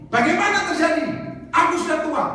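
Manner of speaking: high, loud incredulous retort; Zakaria. Retold or imitated. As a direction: imitate a doubting protest. Meniru Zakaria membantah malaikat. (bantahan tak percaya dengan suara tinggi)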